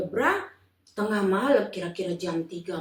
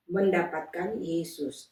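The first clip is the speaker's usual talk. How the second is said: ordinary delivery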